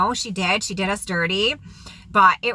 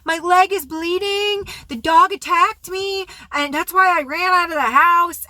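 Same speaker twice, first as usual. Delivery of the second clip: imitated freak-out; 10 semitones higher than usual. Woman claiming dog bite by phone, high mock freak-out. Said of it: "she's freaking out".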